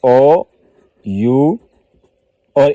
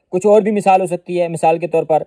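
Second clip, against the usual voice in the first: ordinary talk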